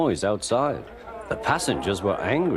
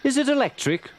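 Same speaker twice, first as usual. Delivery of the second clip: put-on much higher child's voice; high curious child; little girl asking. A narrator's reading. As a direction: ask like a curious small girl. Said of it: "asked Bridget".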